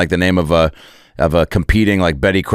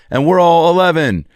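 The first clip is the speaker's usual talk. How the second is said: usual speaking voice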